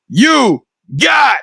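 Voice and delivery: very slow, high, shouted call; drawn-out hype shout; catchphrase sign-off. Real, not acted. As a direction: stretch it out like an announcer.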